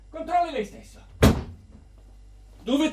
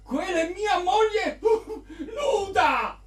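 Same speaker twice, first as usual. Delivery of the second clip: high, laughing sobs; hysterical laughing and crying; 5 semitones higher, slower than usual. Acted. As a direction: lose it, laugh and cry. Husband's breakdown over painting.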